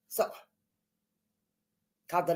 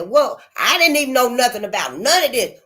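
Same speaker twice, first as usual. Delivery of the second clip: ordinary delivery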